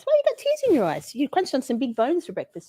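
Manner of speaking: high-pitched puppy talk. Real, not acted. Asking puppy.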